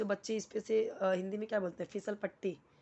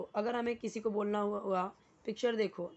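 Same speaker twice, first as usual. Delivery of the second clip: ordinary talk, nothing marked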